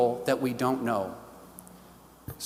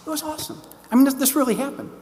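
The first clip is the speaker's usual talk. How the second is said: much higher, with a quick, insistent follow-up; delighted; 11 semitones higher, 3 dB louder, faster than usual. Real, not acted. Telling the audience about the doctor's crucifix, delighted and insistent. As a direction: marvel at it, then swear it was true.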